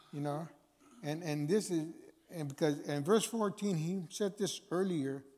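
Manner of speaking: ordinary talk, nothing marked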